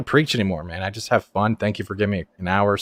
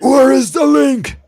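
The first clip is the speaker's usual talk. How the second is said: slow, drawn-out shout, much higher and louder; mock shout; 13 semitones higher, 12 dB louder, much slower than usual. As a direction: bellow it out slowly, teasing. Playful shout for missing link.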